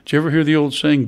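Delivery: ordinary talk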